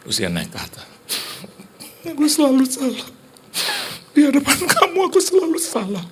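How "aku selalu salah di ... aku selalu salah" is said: loud, very high mock sobbing; imitated crying. Wife goes upstairs feeling always wrong, mock wailing. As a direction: wail it like a wounded wife. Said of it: "lo nggak tahan kalau dia, dia nangis".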